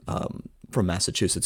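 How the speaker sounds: ordinary delivery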